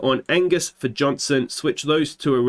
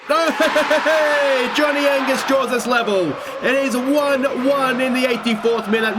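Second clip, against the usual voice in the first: goal celebration shout; 10 semitones higher than usual. Real, not acted. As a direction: roar out the equaliser.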